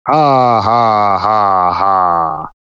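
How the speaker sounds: descending mock evil ha ha; falling staged villain cackle; evil villain laugh.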